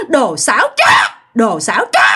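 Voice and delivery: mock ghostly shout, laughing; ghost yelling with laughter. Ghost on the goalpost shouting at the lying boyfriend. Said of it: "Nói vậy đó các bạn".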